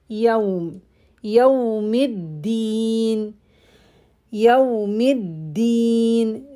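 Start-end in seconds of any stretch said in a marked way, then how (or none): 1.2-6.4 Yawmiddin slow, with a voiced d; clear dal. Shown as a demonstration. Teacher modelling dal, not ta. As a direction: hold Yawmiddin with a clean voiced d. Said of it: "Dal, not ta".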